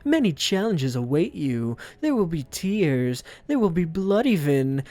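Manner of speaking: high, fierce rousing oration; Professor Dog. Acted. Professor warning students of challenges, angry rousing speech. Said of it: "Oh, he's angry".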